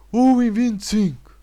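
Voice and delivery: loud, slow, over-articulated yell of the price; shouting the price. Retold or imitated. Shop lady shouting the price at a customer who misheard, slow loud yell. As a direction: bellow it slowly as if he were deaf. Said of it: "está a senhora a gritar".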